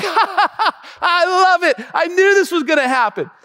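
high, laughing voice; mock laughing family. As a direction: laugh it off as if planned. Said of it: "It wasn't like".

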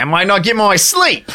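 mock-Australian grumbling; Australian accent. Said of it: "Can we promise not to do Australian accents".

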